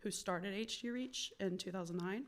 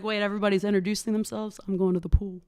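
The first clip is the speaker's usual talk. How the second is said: much louder, quick mock disbelief then exit; loud, retold balking; 11 dB louder, faster than usual. Young woman dodging group introductions, loud balking. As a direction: blurt it loudly, acting out your younger self.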